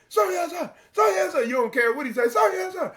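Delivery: high shouted military reply; loud gung-ho soldier. Retold or imitated. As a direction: bark it like a fresh recruit. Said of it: "you're gonna be like".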